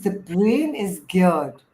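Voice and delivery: ordinary delivery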